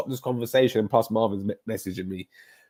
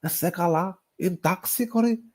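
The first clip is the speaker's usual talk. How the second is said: imitating a Scottish Greek neighbour's accent, pitched up; 7 semitones higher than usual. Mimicking his childhood neighbour Stella, in a Scottish Greek accent. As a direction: Greek words in a broad Scottish accent, climbing higher. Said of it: "it was a Scottish accent".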